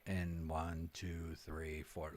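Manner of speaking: very soft, low distant count; away from the mic. Shown as a demonstration. Coach demonstrating mic distance, faint and far off. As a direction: count while backing off the microphone. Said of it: "as I move away from the microphone".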